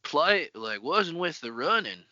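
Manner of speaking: hammed-up Texan drawl, first words stretched and loud; Texan accent. Imagining his street slang as cowboy talk, real Texan. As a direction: lay on a thick mock Texan twang. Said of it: "he goes real Texan with it".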